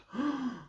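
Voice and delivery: a sharp dramatic gasp; surprised gasp. Acted. Reader reacting to the empty square, gasping. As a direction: gasp in shock.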